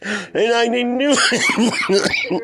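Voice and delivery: slow, high mock innocence; mock protest. Real, not acted. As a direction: drag it out innocently.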